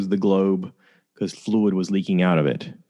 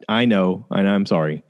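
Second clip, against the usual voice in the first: ordinary talk, nothing marked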